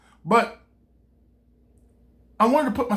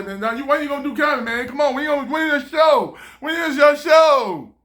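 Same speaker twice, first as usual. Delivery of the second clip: higher and louder, building to a shout; pushy friends; 6 semitones higher, 5 dB louder than usual. Retold or imitated. Friends nagging Don about his show, building to a shout. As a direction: keep pressing him, rising to a yell. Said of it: "people always say".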